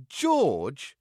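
loud, drawn-out rising question; grimacing disbelief. A narrator's reading. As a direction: repeat the name with appalled distaste. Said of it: "his mother grimaced".

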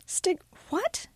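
put-on barbed English accent. A narrator's reading. English teacher baffled by Miranda's stigmata, high English accent. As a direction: break off puzzled, high and English. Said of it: "uses her accent to barb everything she says".

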